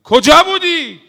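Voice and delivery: very loud, high harsh shout; retold shout. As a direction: bark the question at her. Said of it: "داد زد سر بی بی". (فریاد خشن و بلند)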